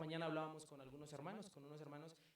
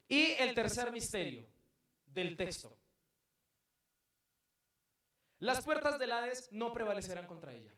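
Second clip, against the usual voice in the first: loud, higher preaching peak; fiery preaching; 5 semitones higher, 7 dB louder than usual. Acted. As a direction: proclaim it to the whole church. Preacher counting the mysteries loudly. (predicación ardiente a voz en cuello)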